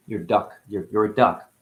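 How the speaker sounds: normal talking voice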